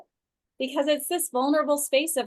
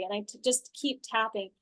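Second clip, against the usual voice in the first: ordinary talk, nothing marked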